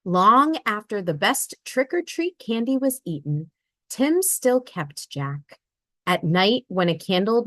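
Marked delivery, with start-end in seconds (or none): none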